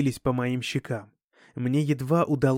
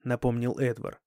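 ordinary delivery

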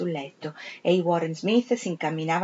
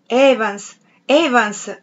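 loud cry of Evans, a bit higher; shouting a name; 3 semitones higher, 6 dB louder, much slower than usual. Acted. Septimus crying out to dead Evans, loud shout. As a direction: call out Evans twice, loud. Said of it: "gridò Septimus".

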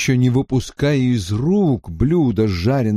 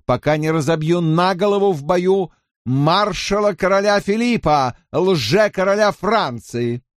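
raised, ringing vow of a marshal; loud proclamation; 7 semitones higher than usual. Acted. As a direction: proclaim the oath to the hall. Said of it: "он громко провозгласил". (громкое торжественное провозглашение)

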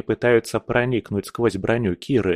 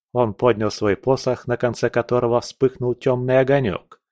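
ordinary delivery

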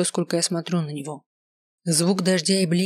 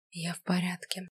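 breathy whispered denial; whispering; 9 dB quieter than usual. Acted. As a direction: whisper it, barely audible. Tearful girl hides her state.

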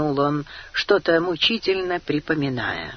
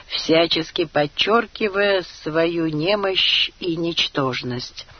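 ordinary delivery, nothing marked

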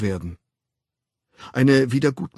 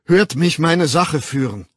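loud, higher-pitched appeal; earnest call; 6 semitones higher, 8 dB louder than usual. A narrator's reading. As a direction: plead your case to a crowd. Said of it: "rief der Wilde redlich". (laut und redlich bittend)

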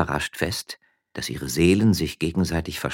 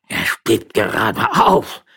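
slow, loud roared repeat; roaring; 5 semitones higher, 9 dB louder, slower than usual. Acted. Magistrate roaring back his wife's words. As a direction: bellow her words back. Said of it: "brüllte Don Eugenio".

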